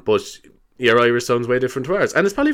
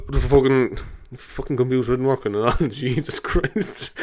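mild mock frustration. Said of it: "He's like".

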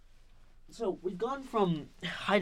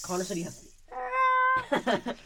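teasing; 5 dB louder, slower than usual. Real, not acted. Teasing Connor.

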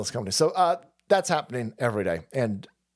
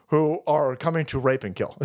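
ordinary talk

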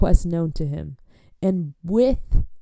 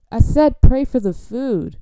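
loud, slow, higher repeat; exasperated order; 4 semitones higher, 7 dB louder, slower than usual. Acted. Host repeating the order to say grace, exasperated loud insistence. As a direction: insist loudly over the praying.

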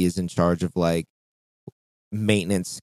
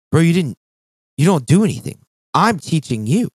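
raised, louder, disbelieving comeback; exasperated retort; 7 semitones higher, 4 dB louder than usual. Retold or imitated. To the coworker who got the basket, an exasperated raised retort. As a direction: call out the coworker who does nothing. Retelling his own reply to coworker.

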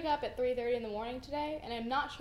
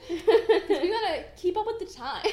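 laughing; 6 semitones higher, 10 dB louder than usual. Real, not acted. Mock-cool joking.

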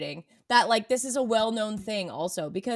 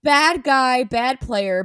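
louder, punchy verdict; loud mock verdict; 3 semitones higher, 8 dB louder, much slower than usual. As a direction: bark it out mockingly. Mocking recap of the quote.

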